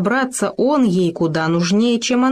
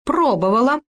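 cheeky; 6 semitones higher than usual. A narrator's reading. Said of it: "нахально ответила она".

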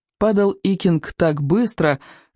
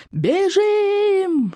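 high yelled warning; panicked shout to flee the dragon. Acted. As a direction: scream at them to run. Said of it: "что есть мочи заорал Икинг".

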